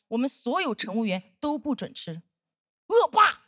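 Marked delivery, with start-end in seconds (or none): 2.9-3.4 loud, high outburst; exclaimed accusation. Acted. Comedian denouncing the bullying senior colleague, loud outburst. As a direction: cry it out as a denunciation.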